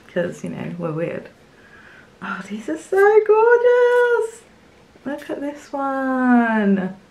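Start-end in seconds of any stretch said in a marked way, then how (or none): 2.2-7.0 high, slow, drawn-out gasp of delight; swooning over a gift she loves; thrilled gush. Real, not acted. Opening a fan's gift, gushing over the postcards in delight.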